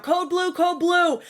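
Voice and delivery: high-pitched mock emergency call; imitated yelling. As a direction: call it out high like rushing staff, without real volume. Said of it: "yelling out".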